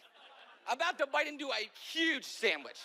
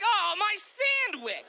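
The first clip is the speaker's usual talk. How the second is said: high, loud, slow wail over the sandwich; hammed-up grief, stretched out; mock anguish; 8 semitones higher, 5 dB louder, much slower than usual. Dropping his sandwich, loud high mock wail. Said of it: "and I'm like".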